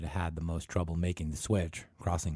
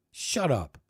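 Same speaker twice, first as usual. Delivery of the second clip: loud high snap; yelled; 7 semitones higher, 4 dB louder than usual. Acted. Ted yelling at Ann to shut up. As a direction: snap it at her. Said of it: "Ted yelled at her".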